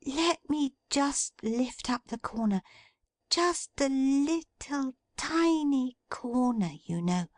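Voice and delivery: put-on high, gentle girl's voice; gentle Meg. A narrator's reading. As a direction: coax him along playfully. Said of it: "with the utmost gentleness".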